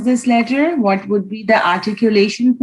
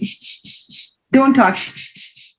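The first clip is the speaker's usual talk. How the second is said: shushing; 5 semitones higher than usual. Shown as a demonstration. Teacher shushing a pretend talker to show the sheen sound. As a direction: hush someone with shh, shh. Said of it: "we are trying to stop someone".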